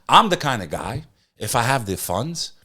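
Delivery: normal talking voice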